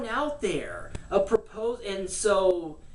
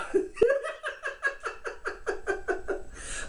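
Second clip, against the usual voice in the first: breathy high-pitched giggling laugh; real giggle at his own joke; high unvoiced giggle; 4 dB quieter than usual. Real, not acted. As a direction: let a high giggle run out.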